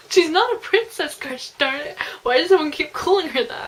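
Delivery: high, exasperated outburst; indignant. Real, not acted. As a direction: protest loudly on the heroine's behalf. Reader indignantly talks back to book.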